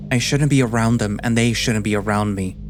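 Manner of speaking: usual speaking voice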